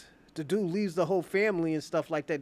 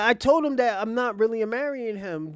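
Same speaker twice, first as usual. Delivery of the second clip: louder, higher retold insistence; exasperated protest; 5 semitones higher, 6 dB louder than usual. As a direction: act out a fiancee insisting she said so. Acts out exasperated fiancee.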